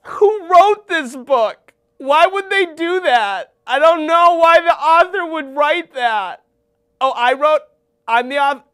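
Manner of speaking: hammed-up fury at the author; mock outrage.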